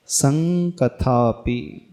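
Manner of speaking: संकथापि slowly, with a held ng in सं; teaching demo of संकथापि, nasal ng drawn out; correct anusvara. Shown as a demonstration. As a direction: hum the सं of संकथापि as ng before क. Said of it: "तो उसे कैसे उच्चारण करेंगे".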